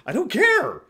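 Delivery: loud, high, fed up; exasperated outburst. Real, not acted. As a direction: blurt it out, exasperated. Exasperated outburst about the show.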